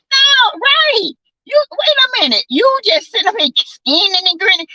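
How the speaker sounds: high, indignant sass; sassy disbelief. Retold or imitated. Telling off the grinning man, high indignant sass. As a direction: pitch it up with outrage.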